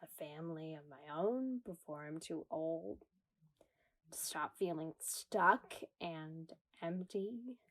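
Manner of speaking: soft unvoiced whisper; whispered confession. Woman admitting a private longing in a whisper. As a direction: barely breathe the secret wish.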